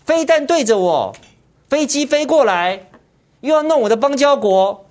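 mocking the party's endless grievances, whiny and high; mock whining complaint. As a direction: whine like someone picked on and pointing fingers. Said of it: "整天骂中国大陆".

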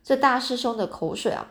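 ordinary delivery

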